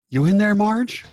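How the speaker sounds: loud, high-rising call. Acted. Robinson Red knocking on Marge's head, loud calling out. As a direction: holler it as if knocking to get through.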